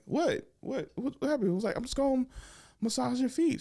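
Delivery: high, wide-eyed fake innocence; mock innocent. Feigning innocence to date.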